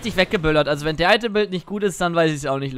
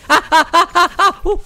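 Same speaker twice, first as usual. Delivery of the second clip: high loud pained ah's; pained gasps; 12 semitones higher, 10 dB louder than usual. Real, not acted. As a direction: gasp from a burnt tongue. Burning mouth gasps.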